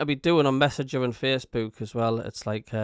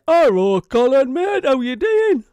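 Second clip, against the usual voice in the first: loud, high-pitched hammed-up greeting; Davey impression; 12 semitones higher, 12 dB louder than usual. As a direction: belt out an exaggerated hello, way up high. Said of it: "or as Davey would say".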